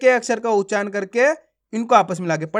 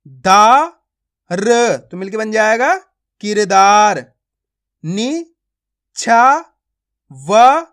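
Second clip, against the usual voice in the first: slow, loud syllables with pauses, then the whole word; teacher sounding out Hindi words for young readers; phonics drill; 5 dB louder, slower than usual. Shown as a demonstration. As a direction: sound out each syllable for children, then blend.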